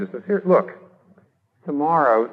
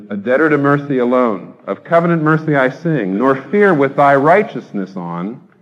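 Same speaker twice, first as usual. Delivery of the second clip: louder, measured hymn recitation; reciting a hymn; 6 dB louder than usual. Acted. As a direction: declaim the old hymn with weight. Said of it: "John Newton says".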